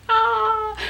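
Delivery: high, loud squeal of delight; excited squeal. Real, not acted. Fan holding the Stranger Things guide, squealing.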